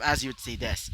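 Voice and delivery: plain reading voice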